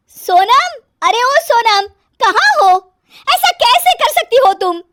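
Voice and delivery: very high, loud yell for someone; shrieked summons turning into reproach; calling out crossly. Acted. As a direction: shout through the house, scandalised. Calling out angrily for sister-in-law.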